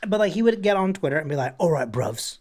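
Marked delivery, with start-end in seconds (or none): 1.6-2.4 a light London twang on "All right, bruvs"; mock London greeting. Statham greeting his Twitter fans, mock London accent. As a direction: toss off "bruvs" like a London lad. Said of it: "he doesn't say bruv".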